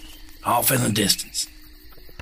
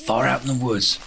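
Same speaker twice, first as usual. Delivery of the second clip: ordinary talk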